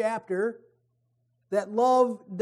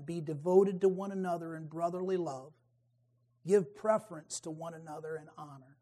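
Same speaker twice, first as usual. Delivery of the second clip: softer, gentle reading of the verse; soft closing scripture; 4 semitones lower, 6 dB quieter, faster than usual. Shown as a demonstration. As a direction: read it softly as a closing thought. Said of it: "Romans twelve ten".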